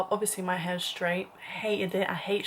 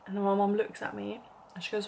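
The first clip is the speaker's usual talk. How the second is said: ordinary talk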